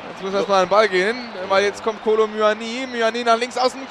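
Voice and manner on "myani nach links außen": usual speaking voice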